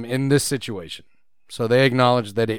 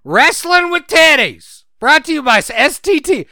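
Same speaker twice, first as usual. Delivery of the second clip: excited pitch; 11 semitones higher, 7 dB louder than usual. Pretend. Hyping a made-up wrestling show to his co-host, loud and high.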